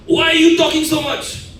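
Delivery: high, loud shouted complaint; acted shout. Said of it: "suddenly one of you starts shouting at me".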